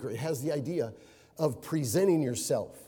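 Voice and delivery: ordinary delivery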